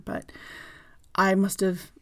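normal talking voice

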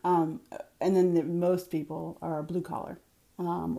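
ordinary delivery

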